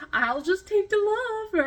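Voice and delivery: sweetly dopey, just soaking it in; clueless little boy cat. Pretend. Waffle the clueless cat accepting cuddles, high slow dopey. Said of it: "poor little clueless little boy".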